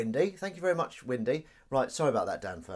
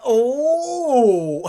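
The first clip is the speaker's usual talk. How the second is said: very high, loud "oh"; long shrieked whoop of surprise; startled yelp; 20 dB louder than usual. Real, not acted. Streamer caught out by a noise going off, real startled yelp.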